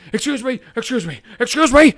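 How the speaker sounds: high-pitched mock pestering neighbor; imitated woman urgently demanding attention, building; frantic woman. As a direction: climb to a loud, insistent last call. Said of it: "this woman runs over to me".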